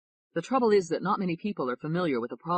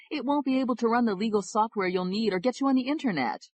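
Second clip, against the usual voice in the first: put-on higher-pitched, insistent older lawyer; brash lawyer friend; 7 semitones higher than usual. Lawyer friend scolding David about resources, insistent. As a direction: press him with a list of problems. Jesse voiced higher throughout.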